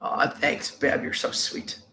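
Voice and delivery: whispered, tender thanks; soft thanks. Real, not acted. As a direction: breathe the thanks softly to a viewer.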